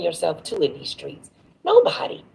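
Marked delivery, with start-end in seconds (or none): 1.6-2.2 high, loud emphatic outburst; passionate. Real, not acted. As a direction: rise up hard on nobody. Preaching to young men.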